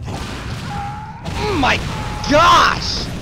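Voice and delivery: ordinary delivery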